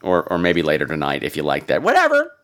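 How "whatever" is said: squealed high, dismissive whatever; high mock whatever. Host brushing off her own joke, high squeal.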